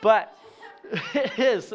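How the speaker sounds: a real laugh through the words; chuckling agreement. Real, not acted. Laughing at his own point.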